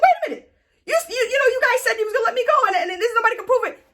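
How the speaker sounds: high, rapid mock panic; panicked protest. Condemned man protesting to his hangmen, mock-panicked protest. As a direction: plead fast in a squeaky high pitch, imitating a condemned man.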